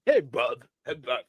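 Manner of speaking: low wrestler imitation; deep-voiced Ospreay mimicry. Host mimics the wrestler to his co-host, deep mock voice. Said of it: "Why your voice so deep?".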